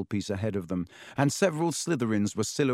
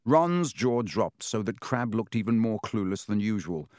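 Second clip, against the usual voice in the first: plain reading voice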